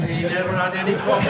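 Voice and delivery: can barely get it out for laughing; laughing through it. Real, not acted. Teller laughs at own punchline.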